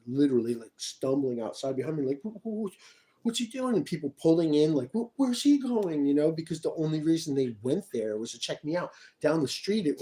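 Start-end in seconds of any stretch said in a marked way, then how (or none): 2.2-3.8 high, panicky mock voice; a high flustered squeak, imitating her; mock flustered woman. Mocking stumbling woman. 4.9-6.1 high, panicky mock voice; a high flustered squeak, imitating her; mock flustered woman. Mocking people pulling in.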